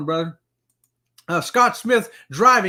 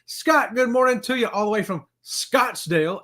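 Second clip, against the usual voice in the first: ordinary delivery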